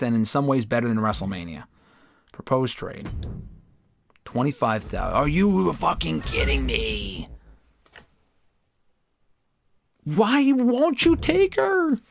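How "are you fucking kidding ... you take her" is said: slow, high, drawn-out exasperation; real frustration; exasperated disbelief. Real, not acted. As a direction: plead at the game, stretched and high. Another rejection.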